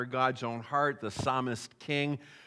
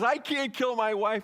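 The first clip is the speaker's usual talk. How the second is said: high pleading cry, lightly done; a mock sob over the wife; retold crying; 7 semitones higher than usual. Candidate tells agents, in mock tearful voice. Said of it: "he's bawling his eyes out".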